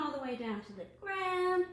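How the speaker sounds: put-on bouncy chant for children; action rhyme. Librarian leads children in an action rhyme, bouncy chant. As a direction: lead the kids through moving.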